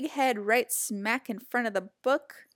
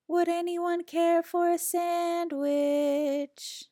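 high, slow, far-off call; faint distant voice; 8 semitones higher, slower than usual. A narrator's reading. Distant creature calling to the pig scouts. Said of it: "a faint voice far in the distance".

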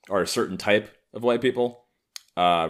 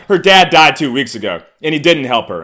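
mock protest; 8 semitones higher, 12 dB louder than usual. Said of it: "they're like".

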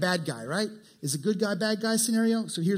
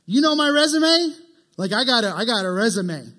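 loud, high boastful brag; mock boastful bragging; 4 semitones higher, 7 dB louder than usual. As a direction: puff up like a braggart showing off. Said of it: "Boy, he's bragging".

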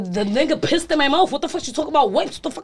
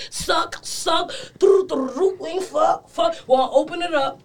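ordinary talk

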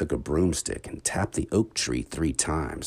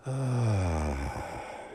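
sleepy sigh; 3 semitones higher, 4 dB quieter than usual. Acted. Falling asleep.

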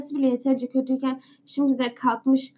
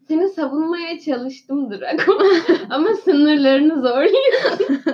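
slow, higher, laughing through it; laughing scold; 5 semitones higher, 6 dB louder, slower than usual. Real, not acted. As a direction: scold Draco between giggles. Scolding Draco while laughing.

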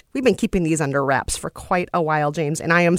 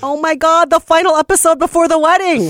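excited; 10 semitones higher, 7 dB louder than usual. Real, not acted. Excited squeal at final episode.

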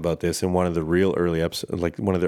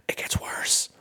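whispered aside; 5 dB quieter, slower than usual. Real, not acted. Ominous aside to listeners.

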